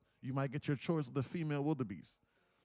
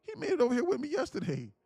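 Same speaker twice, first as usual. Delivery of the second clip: puzzled wildebeest; 6 semitones higher than usual. Acted. Wildebeest wonders about missing friend.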